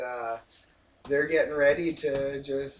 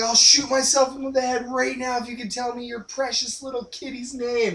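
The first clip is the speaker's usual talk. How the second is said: mock furious Rocco; 8 semitones higher, 3 dB louder than usual. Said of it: "he's like".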